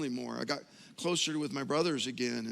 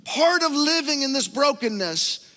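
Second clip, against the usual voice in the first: full-force preaching; 8 semitones higher, 7 dB louder than usual. Acted. Pastor preaching to the church on grief, at full force. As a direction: drive each line home to the congregation.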